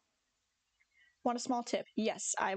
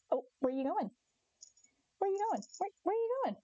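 high, sing-song questioning to a pet; pet talk; 10 semitones higher than usual. Real, not acted. Owner to her cat wandering off, high pet talk. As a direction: coo it high to a straying cat.

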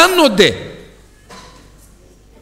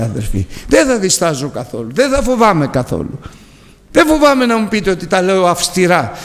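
ordinary talk

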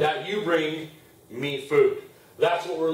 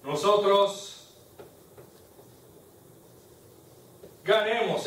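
long pauses between each word; slow dictation; 3 dB quieter, slower than usual. Shown as a demonstration. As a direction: dictate it one word at a time. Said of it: "teacher dictates phrase word by word".

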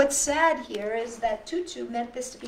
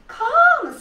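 much higher, louder sing-song call of a friendly lady; cheerful lady; 13 dB louder than usual. Acted. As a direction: call the child over brightly like a kind helper. Lady invites kids to lei table.

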